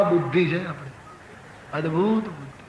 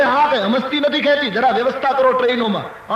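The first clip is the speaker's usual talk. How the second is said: higher-pitched, mildly outraged retort; acted indignation, voice pitched up; indignant scolding; 7 semitones higher, 4 dB louder than usual. Grandfather answering the train official about the chain, indignant. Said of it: "એકદમ આક્રોશ ભર્યા સ્વરમાં".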